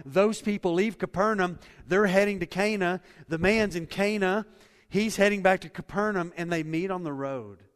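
loud, driving sermon delivery; full-force preaching. Acted. Pastor urging the church to trust, loud preaching. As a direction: preach it out, pushing every line.

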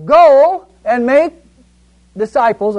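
loud and slow, calling it out; loud proclamation. Shown as a demonstration. Teacher showing the class the angel's loud voice, proclaiming slowly. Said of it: "what do you want to do with the word loud".

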